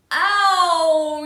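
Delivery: sharp, loud high cry; yell of pain. Acted. Boy knocked down, yelling in pain. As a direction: yelp out as you hit the floor. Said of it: "yells Schubert".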